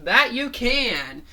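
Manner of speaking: plain reading voice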